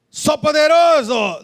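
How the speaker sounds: drawn-out, high proclamation; puffed-up declaration, stretched out; mock boast. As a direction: boast it slowly and grandly. Acting out a boast Jesus refused.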